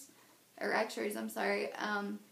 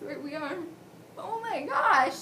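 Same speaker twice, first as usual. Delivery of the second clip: overwhelmed outburst; 8 semitones higher, 6 dB louder than usual. Real, not acted. Breaks down while telling story.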